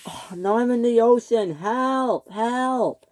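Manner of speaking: high pleading help, help; mild high cries for help; calling for help. Acted. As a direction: call out as you drift away. Timothy floating in ocean.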